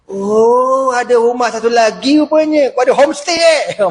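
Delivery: high, sarcastic indignation; angry Umar. Acted. Umar angrily mocks Abu Darda's second house. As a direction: scoff at the hidden house. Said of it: "Marah lah Omar".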